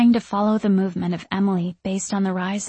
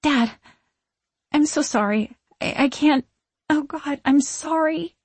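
high, faltering plea; distraught apology; 7 semitones higher than usual. A narrator's reading. Daughter apologising to her ghostly father, high and distraught. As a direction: climb higher as the apology breaks up.

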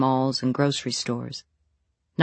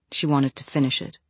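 usual speaking voice

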